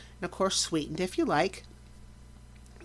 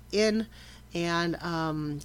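ordinary talk

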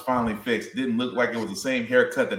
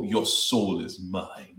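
Shang Tsung; 4 semitones lower, 6 dB quieter, much slower than usual. Retold or imitated. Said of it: "just to hear him just go".

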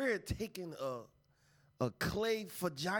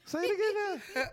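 excited; 14 semitones higher, 4 dB louder than usual. Real, not acted. Excited request.

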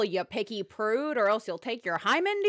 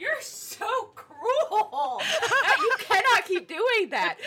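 higher, slow, laughing complaint; laughing protest; 10 semitones higher, much slower than usual. Real, not acted. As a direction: whine through real laughter. Teased friend protests laughing.

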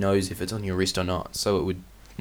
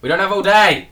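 impatient shout; 9 semitones higher, 9 dB louder than usual. Real, not acted. Hurrying a slow rater.

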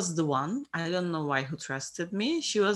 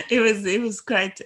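laughing; 2 semitones higher, 6 dB louder than usual. Real, not acted. Laughing at the memory.

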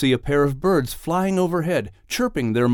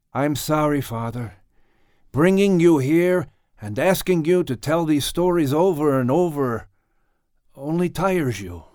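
lightly voiced caring son; Noah's apologetic voice; 3 semitones higher than usual. A narrator's reading. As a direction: apologise gently to an old father. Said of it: "Noah said as he patted the blankets".

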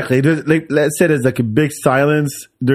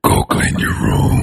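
slow breathy whisper; spooky hooded whisper; creepy whisper voice; slower than usual. Shown as a demonstration. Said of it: "cloak over your head".

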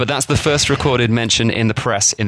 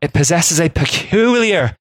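slipping into Irish; 5 semitones higher than usual. Shown as a demonstration. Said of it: "It's gone Irish now".

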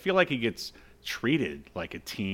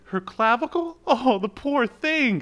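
ditzy woman; 14 semitones higher, 5 dB louder than usual. Retold or imitated. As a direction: coo it like a dim-witted sweet lady. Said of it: "Bambi is like".

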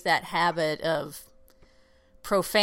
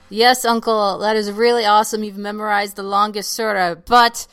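louder, mock polite impatience to an uncle; hungry family teasing the uncle, louder; mock impatient to uncle; 7 dB louder than usual. Joke addressing long-praying uncle.

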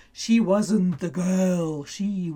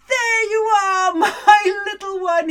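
tearful joy; 10 semitones higher, 5 dB louder than usual. Acted. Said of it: "he said with tears in his eyes".

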